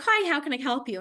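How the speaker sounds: high, chipper mock doctor hello; friendly doctor greeting. Doctor welcoming a new patient, friendly.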